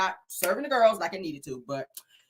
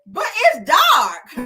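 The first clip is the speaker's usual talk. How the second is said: mock annoying jab; 11 semitones higher, 8 dB louder, much slower than usual. Retold Tisha jab.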